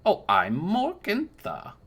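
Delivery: put-on high, slow old woman voice; a sweet old crone giving her name; drawn-out, high granny voice; old crone introducing herself.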